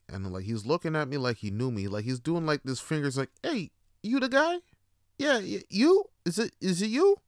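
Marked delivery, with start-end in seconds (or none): 3.4-7.2 high, eager questioning voice; questioning stranger. Acted. Stranger on the bus checking he has his man, high and questioning. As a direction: call over in a high, tentative voice.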